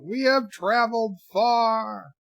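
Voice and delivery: high, drawn-out proclamation; mock grand yell, stretching the words; Sybok's yell. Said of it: "Sybok yells out".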